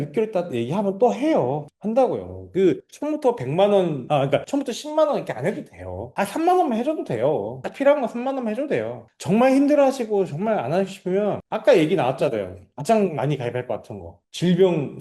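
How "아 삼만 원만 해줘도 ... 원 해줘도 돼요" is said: reassuring sales pitch. Acted. Models an easy, reassuring pitch to client.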